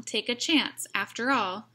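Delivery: ordinary delivery, nothing marked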